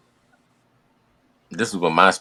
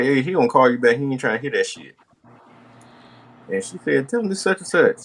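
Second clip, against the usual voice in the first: grandma voiced; 8 semitones higher than usual. Grandma relaying on phone.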